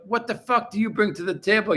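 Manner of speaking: ordinary talk, nothing marked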